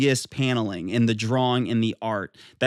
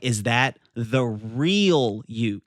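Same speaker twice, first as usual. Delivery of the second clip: slow quote, REAL drawn out and emphasized; stress on REAL; 2 semitones lower, much slower than usual. Acted. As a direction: lean slowly into the word "real". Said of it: "ooh, the emphasis on real".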